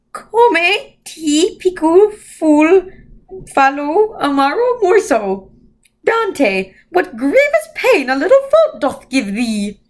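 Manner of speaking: high, slow otherworldly voice; eerie Mrs Who. A narrator's reading. Strange old being quoting Dante to Mrs Whatsit, high slow eerie voice. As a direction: drift the words like an old spirit.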